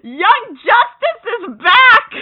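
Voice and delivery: high, loud, drawn-out cheer; triumphant cheer. Retold or imitated. Bart on his knees before the team, triumphant slow cheer. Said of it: "on his knees cheering".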